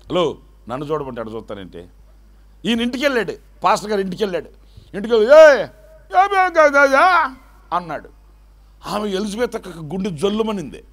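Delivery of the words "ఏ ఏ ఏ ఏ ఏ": loud, high wordless bleating; mute straining sounds. Retold or imitated. Struck-dumb priest trying to talk, loud high bleats. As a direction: try to speak but only strain out sounds.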